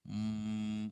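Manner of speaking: మ్మ్ as a soft held hum; quiet sustained nasal మ్మ్; nasal demonstration. Shown as a demonstration. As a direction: hum మ్మ్ on one level note, lips closed. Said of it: "గాలి నోట్లోకి వచ్చింది".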